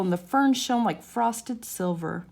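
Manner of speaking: plain reading voice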